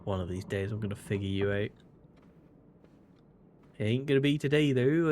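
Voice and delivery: half-done American drawl that wanders off; slipping American drawl. Acted. Gamer taunting a character in a lightly American drawl. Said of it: "I don't really know what happened to my accent there".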